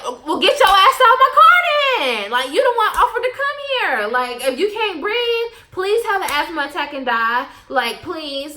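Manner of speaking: high, loud mock sass through laughter; cheeky laughing comeback to the officer; laughing retort. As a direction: cackle it at him.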